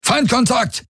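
high, sharp alarm call; warning shout. A narrator's reading. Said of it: "rief eine Golwonenstimme".

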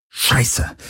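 unvoiced, hissed swearword; hissed curse. A narrator's reading. Galen reacting to the boarding threat, hissed. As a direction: hiss it under your breath. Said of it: "zischte Galen".

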